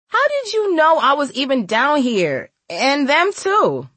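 high-pitched, drawn-out questioning; incredulous girl. A narrator's reading. Girl asks how woman found her, high incredulous. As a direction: ask in high girlish disbelief, stretching the end.

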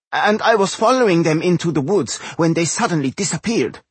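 high-pitched little gnome voice; high gnome character voice; gnome reporting. A narrator's reading. Narrator plays gnome reporting.

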